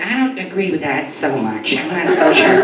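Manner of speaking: lightly voiced skeptical woman; audience women. Comic imitates the women in the audience, lightly voiced doubt. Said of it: "The women are like".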